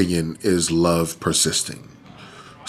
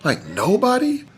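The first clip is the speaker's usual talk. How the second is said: incredulous; 10 semitones higher than usual. Real, not acted.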